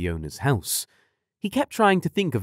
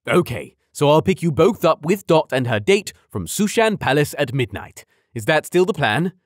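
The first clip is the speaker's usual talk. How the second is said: loud, high fatherly check; hearty dad voice; 6 semitones higher, 5 dB louder than usual. A narrator's reading.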